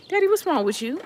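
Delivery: very high, incredulous question; acted bewilderment. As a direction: question a panicking parent in mock alarm. Retelling questioning her hollering dad.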